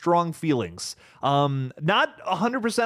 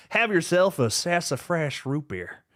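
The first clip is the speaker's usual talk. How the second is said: fake cowboy accent; 4 semitones higher, slower than usual. Continues cowboy chat voice.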